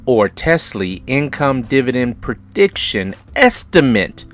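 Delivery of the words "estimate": Estimate set apart and punched higher; stress on Estimate. Real, not acted. Host warning viewers the dividend figure is a prediction, emphatic Estimate. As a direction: hit Estimate hard as a caveat. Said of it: "emphasize the word".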